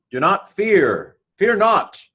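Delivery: slow, soaring declaration of the verse; proclaimed. Shown as a demonstration. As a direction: call it out like a herald.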